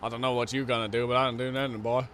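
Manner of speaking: put-on southern US accent. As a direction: play a good old southern boy. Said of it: "something that becomes southern".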